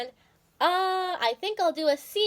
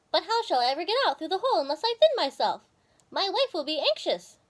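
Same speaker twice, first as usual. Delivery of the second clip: a touch higher, fast worried squirrel; lightly voiced Timmy; 6 semitones higher than usual. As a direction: fret about getting out, a bit higher. Timmy speaks in story.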